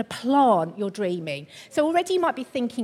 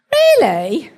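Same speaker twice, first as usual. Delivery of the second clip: loud, higher mock-sceptical query; doubting wife; 4 semitones higher, 9 dB louder than usual. Wife doubting her husband's soil claim, loud mock incredulity. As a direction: act out a wife's loud disbelief. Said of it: "did the really good wa-, wife thing".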